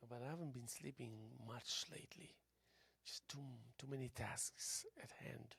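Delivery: tired whispered aside; whispering. Real, not acted. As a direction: drop to a whisper.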